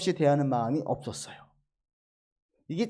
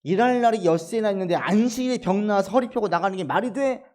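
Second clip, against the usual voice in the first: higher and louder, mimicking a furious synagogue leader; retold angry outburst; 5 semitones higher, 5 dB louder than usual. Synagogue ruler rebukes the healed woman, angry incredulous outburst. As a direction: spit out the complaint, pitch jumping on the last word. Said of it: "화를 내요".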